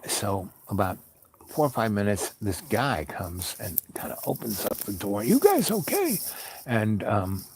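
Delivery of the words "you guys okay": loud, high-pitched rescuer's call. Retold or imitated. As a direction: shout in to check on them. Rescuer calling into the wrecked car.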